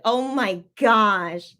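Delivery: ordinary talk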